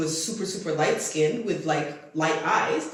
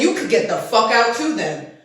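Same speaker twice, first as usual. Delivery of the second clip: louder, raised voice telling them off; retold angry retort; 4 semitones higher, 8 dB louder than usual. Narrator to roommate, kicking her out: loud angry retort. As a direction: snap back, voice up and loud. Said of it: "I said".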